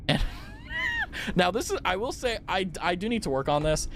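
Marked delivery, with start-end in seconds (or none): 0.3-1.3 high laugh. Real, not acted. Laughing at own intimidation habit.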